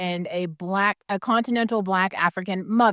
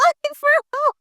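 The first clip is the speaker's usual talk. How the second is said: incredulous laugh, much higher; laughing disbelief. Real, not acted. Repeats question laughing.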